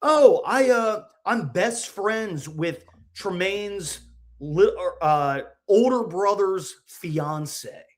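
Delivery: lightly voiced girl; girl's voice. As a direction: retell her like a chatty fan. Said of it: "She goes".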